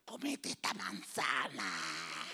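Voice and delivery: slow, low, soft temptation; drawn-out hushed coaxing, mock-sinister; tempter's whisper. Preacher mocking the gossip as Eden's tempter, slow and hushed. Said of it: "Solo falta que diga".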